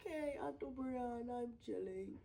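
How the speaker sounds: put-on high, playful Indian accent through laughter; hammed-up Indian accent, near crying with laughter. Sister answering her brother in a mock Indian accent. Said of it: "And I'll be like".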